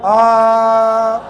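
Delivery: one long open vowel, voiced steadily; sustained demo "aaa". Shown as a demonstration. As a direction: hold a loud, level "aaah". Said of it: "अरे कितनी देर चिल्लाए हम". (लंबी, एक सुर में "आ" की आवाज़)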